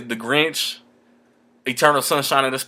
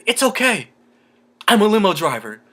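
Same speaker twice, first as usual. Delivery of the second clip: much higher, put-on movie voice; imitating the character; 7 semitones higher than usual. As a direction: play it like the clueless hero. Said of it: "He said".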